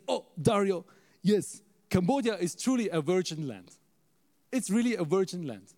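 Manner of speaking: emphatic, acted Ghanaian English; Ghanaian accent. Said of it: "so he speaks a little different".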